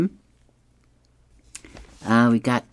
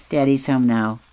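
ordinary talk

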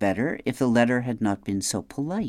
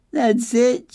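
sobbed; 13 semitones higher, 6 dB louder than usual. Acted. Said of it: "she sobbed".

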